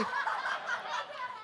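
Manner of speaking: a squeaky high giggle at his own joke. Real, not acted. Pastor giggling at his own text impression, high. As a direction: break into a high, light giggle.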